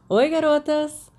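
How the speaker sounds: put-on boy calling loudly down a long table. A narrator's reading. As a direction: hail them from down the table. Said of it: "Sirius chamou de mais longe".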